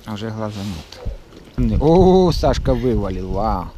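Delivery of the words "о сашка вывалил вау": loud happy burst; excited exclaim. Real, not acted. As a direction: cheer a friend's catch. Friend lands a fish.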